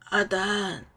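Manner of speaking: put-on hoarse dying old man. A narrator's reading. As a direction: rasp the name weakly. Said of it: "李长青嘶哑的开口".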